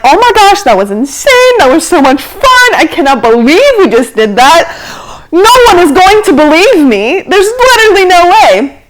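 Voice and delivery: high, gushing mock amazement; thrilled disbelief. Passengers just after the weightless dive, thrilled and amazed. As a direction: gush in amazement, leaping up in pitch.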